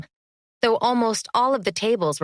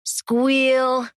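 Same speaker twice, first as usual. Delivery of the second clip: mocking taunt; 6 semitones higher than usual. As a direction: jeer the word up high. Vic taunting after sing-song.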